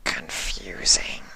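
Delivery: slow breathy alien whisper; whispering changeling. Acted.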